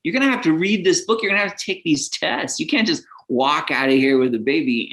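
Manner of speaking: hammed-up clerk chasing him; hospital staff calling. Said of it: "someone to run after me and, like, say".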